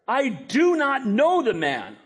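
high, slow, emphatic denial; swearing denial. Acted. Peter curses and swears to bystanders, emphatic denial. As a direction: draw out each word as an oath. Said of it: "began to curse and swear".

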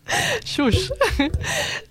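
high squealing burst of laughter. Real, not acted. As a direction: let out a high giggling squeal. Host laughing after "just shush.

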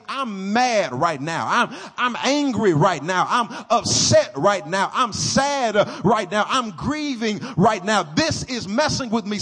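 loud, slow, drawn-out confession of anger; voicing raw emotions. Preacher voicing a congregant owning their anger, loud and slow. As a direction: let each feeling ring out from the pulpit. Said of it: "sit in your emotions and say".

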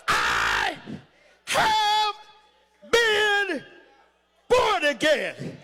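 each of "I have been" held high and separate; long pauses in "I have been born again"; word-by-word proclamation. Real, not acted. Preacher repeats his declaration.

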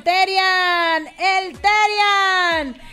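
name stretched out slow and proud; drawn-out shout-out. Real, not acted. Radio DJ calling out a listener's name, stretched and proud.

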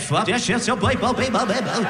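high, bouncing nonsense babble; backwards-record gibberish. Retold or imitated. Mocking wife's sleep talk as hidden message, high backwards babble. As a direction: rattle off syllables like a reversed record. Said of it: "Sounds like you're turning a record backwards".

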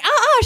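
high mock screams of panic; startled high squeals; retold panicked screams. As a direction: yelp like you're being swooped.

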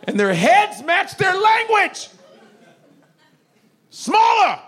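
slow, high and loud; high shout. Acted. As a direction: belt it out up high.

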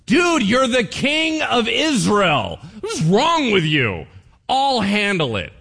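high, loud, slow scolding wife; scolding Jezebel. Acted. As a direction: talk down to your husband. Jezebel scolds her husband.

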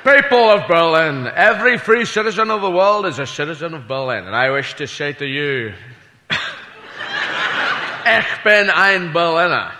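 lightly voiced Kennedy oration; a hint of Kennedy to the crowd; Kennedy speech. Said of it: "he said".

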